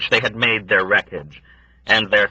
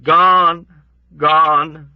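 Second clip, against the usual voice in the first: put-on high, louder, irritated old woman; crabby irritated; 8 semitones higher, 7 dB louder than usual. A narrator's reading. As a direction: snap it out, annoyed by his persistence. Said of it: "with a crabby shrug, irritated".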